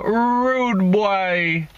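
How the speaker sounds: a Jamaican greeting, stretched on rude; Jamaican accent. Shown as a demonstration. Demonstrating how Jamaicans say it, a mock Jamaican accent. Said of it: "That's how they say it in Jamaica".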